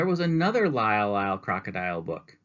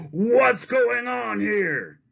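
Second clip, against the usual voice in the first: much higher, louder, slow fury; furious shout; 7 dB louder, much slower than usual. Acted. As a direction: explode at the chaos. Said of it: "voice, charged with fury, shout".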